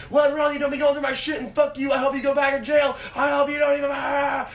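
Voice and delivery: high, loud ranting imitation; acted fury breaking into a wordless yell; mad brother. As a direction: yell it like a sibling losing his temper. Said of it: "Gets all fucking mad".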